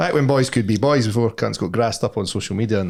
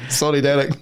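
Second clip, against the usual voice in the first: laughing apology; 2 semitones higher than usual. Real, not acted. Joke about kicking Derek.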